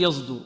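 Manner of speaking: يصدر with the S voiced into a Z; wrong Z colouring. Shown as a demonstration. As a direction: buzz the S of يصدر like a zay, as a mistake. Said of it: "وهذا خطأ".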